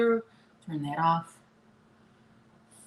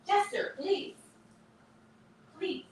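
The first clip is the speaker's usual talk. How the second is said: high, pleading name call; high-pitched please to a yapping puppy; pleading with the dog; 4 dB quieter than usual. Real, not acted. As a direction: beg him to stop.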